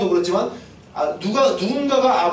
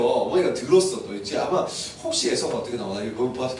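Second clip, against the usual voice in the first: normal talking voice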